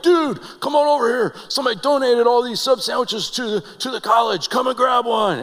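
high, loud dorm buddy's holler; calling him over. Retold or imitated. Dorm guys invite Joel over to free sandwiches, calling out high and loud. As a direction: call out excitedly across the lobby. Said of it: "they're like".

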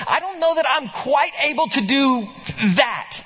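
lightly voiced worried follower; hesitant disciple. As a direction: plead your uncertainty to Jesus, voice lifted. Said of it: "a faith that says".

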